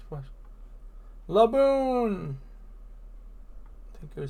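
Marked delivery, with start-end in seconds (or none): 1.3-2.4 loud high call of the name. Acted. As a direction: shout the whale's name across the water. Calling out to the whale.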